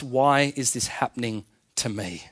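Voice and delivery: WHY IS THIS HAPPENING TO ME, low and soft, slowing before TO ME; searching question. Shown as a demonstration. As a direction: ask it softly, pausing before "to me". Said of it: "You hear the difference?".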